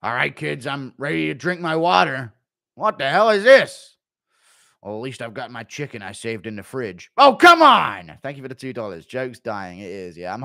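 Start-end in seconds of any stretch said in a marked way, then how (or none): 0.0-8.2 thirsty man let down twice; Chandler after weigh-in. Acted. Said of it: "Impression of Chandler getting home after".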